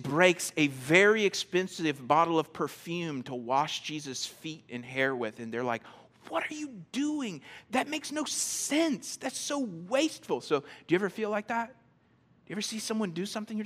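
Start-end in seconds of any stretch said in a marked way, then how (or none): 6.3-10.4 high-pitched, appalled disbelief; acted-out indignant objection, pitched high. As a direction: scold in scandalized astonishment. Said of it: "This is the same word that, that's used to describe the disciples".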